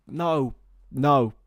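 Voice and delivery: ordinary delivery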